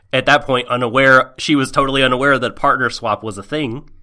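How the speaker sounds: ordinary talk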